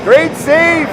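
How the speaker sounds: high, loud cry easing into a high-pitched recap; excited play-by-play. Real, not acted. Announcer calling a great save at the doorstep, excited. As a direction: burst out loud, then ease in volume. Live call of a close chance.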